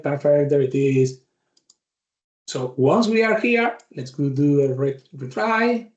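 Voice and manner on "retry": ordinary talk, nothing marked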